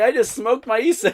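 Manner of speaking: high, loud comic complaint; mock panicked protest. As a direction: act out a desperate excuse for laughs. Retelling panicked protest to friend.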